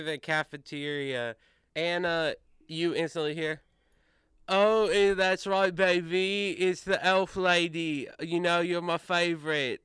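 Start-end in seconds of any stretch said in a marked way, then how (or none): 4.5-9.8 lightly raised, sweet-talking schmoozer; Austin Flowers. Acted. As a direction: gush over her like a smarmy old flame. GM voices Austin Flowers greeting.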